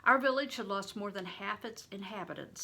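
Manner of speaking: plain reading voice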